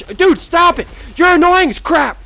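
high, loud complaint; exasperated yell. Real, not acted. Gamer pestered by an enemy, exasperated yell. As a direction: yell at a pest.

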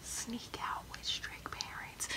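hushed, drawn-out whisper; secretive slow whisper. Pretend. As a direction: whisper it slowly, as if strict parents might hear. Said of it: "See, I'm whispering".

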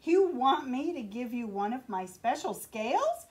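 indignant cry. Acted. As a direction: snap back, insulted at the request. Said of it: "cried the Rainbow Fish".

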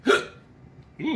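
sudden squeaky hiccup; a real hiccup breaks through. Real, not acted. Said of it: "I'm fighting the hiccups".

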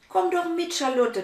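pleading. Acted. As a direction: beg your friend to come. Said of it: "bittet Hannah". (Hannah bittet ihre Freundin, höher und flehend)

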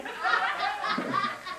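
a high soft burst of laughter; high amused laugh. Real, not acted. Laughing at own story.

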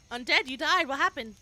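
usual speaking voice

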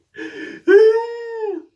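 sharp high mock gasp; dramatic gasp. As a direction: gasp in fake shock. Lonely on Valentine's Day.

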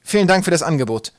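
courteous, projected decline; polite thanks. A narrator's reading. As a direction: thank them politely but firmly. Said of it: "fügte er höflich hinzu".

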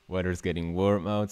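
usual speaking voice

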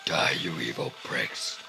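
very low, throaty threat; mock action-hero growl; deep menacing voice. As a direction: condemn them in a hammed-up deep growl. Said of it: "My voice hurts".

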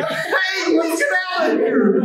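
high, alarmed Goofy shout; Goofy urging Mickey to flee a fire; urgent, room on fire. Shown as a demonstration. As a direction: panic like the room is burning. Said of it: "the room's on fire".

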